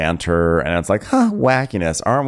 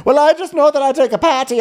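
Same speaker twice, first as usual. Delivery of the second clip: mock mom voice; 4 dB louder, faster than usual. Imitating mom, put-on voice.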